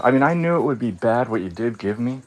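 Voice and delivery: normal talking voice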